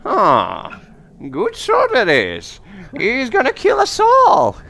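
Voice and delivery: high crone, building to a shrill cry; old seer woman. Acted. Old seer foretells doom from the bones, high loud crone voice. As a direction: start high and slow, rise to a loud prophecy. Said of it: "and she says".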